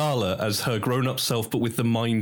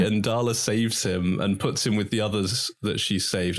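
ordinary talk

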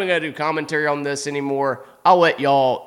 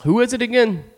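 Peter's question; 10 semitones higher than usual. Retold or imitated. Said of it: "and he's like".

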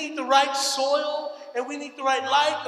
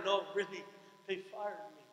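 softer, earnest, downcast; quiet and serious; 5 semitones lower, 9 dB quieter than usual. Retold or imitated. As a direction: say it softly, no longer joking. Insisting it is true, quieter.

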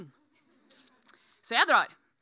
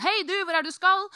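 raised, higher shout at a passer-by; calling after someone; 6 semitones higher, 6 dB louder than usual. Retold or imitated. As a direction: call out pestering questions. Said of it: "han roper etter meg sånn". (roper etter en forbipasserende)

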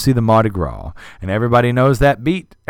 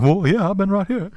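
a hint of a higher easygoing voice; lightly voiced old man; 8 semitones higher than usual. Fess calmly tells Quint he never left, a little higher. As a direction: shrug it off gently.

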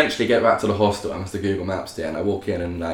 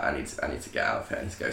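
panicked mutter; 9 semitones lower, 9 dB quieter, much faster than usual. Retold or imitated. Panicking, retold inner voice.

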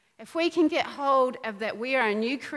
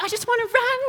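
much higher, weary mock wail; hammed-up plea to drop a heavy burden; whiny high plea; 9 semitones higher, faster than usual. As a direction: whine it like the load is too heavy. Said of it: "and we're like".

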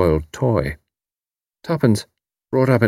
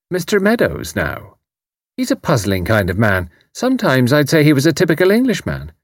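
Irish landlady; 8 semitones higher than usual. A narrator's reading. Mrs O'Rourke voiced.

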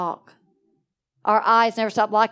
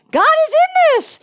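much higher, louder and fast, thrilled; ecstatic exclaim; 7 dB louder, much faster than usual. Retold or imitated. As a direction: shout it out in rapture. Excited retelling of victory.

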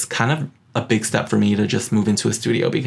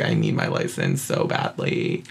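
dropped way low and much softer, a pleading groan; mock desperate plea; 5 dB quieter than usual. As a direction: moan it low and quiet as if you're starving for it. Retold desperate need for license.

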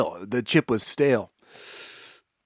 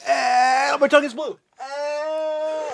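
pained wail; slower than usual. Real, not acted. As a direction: wail as if your mouth is burning. Wailing from the burning chip.

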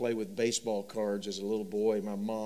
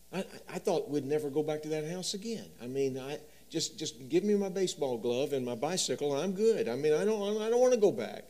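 put-on building higher and louder, panicky boy; child's fear; 6 semitones higher, 3 dB louder than usual. Frightened boy refusing to go back home, rising panic. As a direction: climb into little-boy panic.

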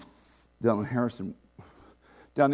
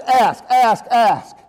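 loud, insistent repeated command; urgent exhortation; 7 semitones higher, 11 dB louder, much slower than usual. Real, not acted. Preacher urges the church to pray, loud and insistent. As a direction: drive the word ask home. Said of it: "urging congregation to ask".

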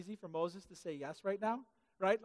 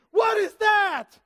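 higher, much louder, slow startled cry; alarmed shout; 11 semitones higher, 13 dB louder, much slower than usual. Acted. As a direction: yell in shock at the magic stick. Stick-wielding evangelist scares passerby.

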